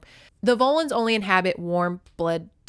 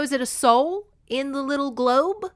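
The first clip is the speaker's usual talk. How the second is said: normal talking voice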